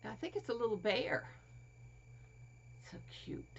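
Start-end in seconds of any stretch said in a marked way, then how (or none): 2.9-3.4 whispered adoring so cute; barely voiced, soft; tender hushed whisper. Real, not acted. Whispering over toy bear.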